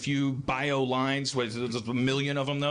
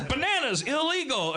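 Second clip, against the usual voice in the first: mock ruling; 11 semitones higher than usual. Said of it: "if a Supreme Court justice has them".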